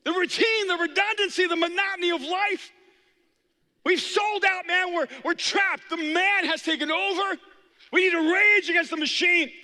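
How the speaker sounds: high, fervent gazelle protester; rally the herd against the system; a gazelle preaching revolt; rebellious gazelle. Pretend. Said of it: "no gazelle saying".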